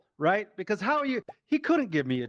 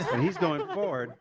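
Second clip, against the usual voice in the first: ordinary talk, nothing marked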